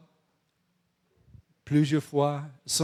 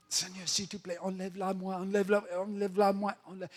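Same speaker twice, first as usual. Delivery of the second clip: higher, hurried begging; insistent pleading; 5 semitones higher than usual. Acted. Paul begging the Lord despite a no, insistent pleading. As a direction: repeat the plea over and over without stopping.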